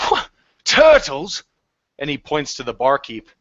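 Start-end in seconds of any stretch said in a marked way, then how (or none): 0.1-1.4 high, loud, slow bewildered cry; a stunned drunk who just heard the word turtles; surprised tavern drunk. Acted. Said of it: "you hear one man say".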